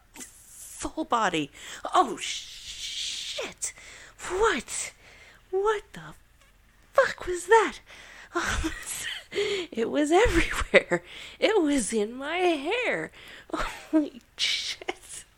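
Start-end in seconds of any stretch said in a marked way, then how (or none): 1.9-8.9 loud startled outburst, pitch jumping high; shocked exclaiming. Real, not acted. Shocked reaction after climax. 9.7-15.2 loud and slowing, stunned disbelief; loud amazed. Real, not acted.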